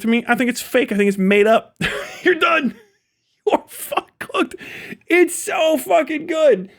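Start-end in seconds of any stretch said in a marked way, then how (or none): 2.2-6.7 much higher, slow, laugh-crying the words. Real, not acted. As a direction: squeal it out while crying with laughter. Cracking up at the comeback.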